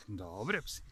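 ordinary talk, nothing marked

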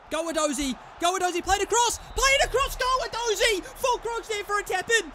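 high, loud, mounting urging; urgent shouting. Real, not acted. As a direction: yell the player on toward goal. Urging players on.

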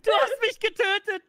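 high, loud accusing outcry; shouting. Real, not acted. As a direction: yell it in outraged disbelief. Accusing teammate after dying.

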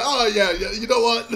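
quoting the clip through laughter; a smug line broken by giggles; laughing mock quote.

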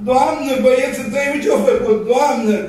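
high-pitched complaining parents calling to the mother. Retold or imitated. Parents complaining to the mother about her boys, calling out high. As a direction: call out to the mother, fed up.

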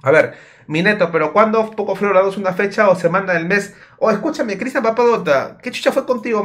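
ordinary talk

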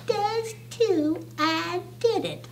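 high, soft sister's denial; dainty, stubborn contradiction; sniffy quarrelling. A narrator's reading.

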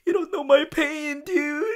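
high, loud, crying whine; hammed-up tearful cry; mock anguish. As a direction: wail your suffering, overdone.

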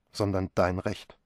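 ordinary delivery

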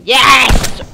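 a loud, punchy burst of triumph; excited yes. Real, not acted. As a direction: celebrate the win. Gamer succeeds at something.

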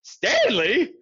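high-pitched motherly cry of the name; shocked exclamation. A narrator's reading. Missus Lambchop to her son after the broadcast, shocked exclamation. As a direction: exclaim in sudden disapproval, voice leaping up. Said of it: "exclaimed Missus Lambchop".

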